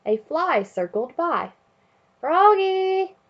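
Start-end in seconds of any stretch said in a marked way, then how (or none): 2.2-3.2 high, loud call. Acted. Coach calling Froggy during play, loud call. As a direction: call him from across the field. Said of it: "called the coach".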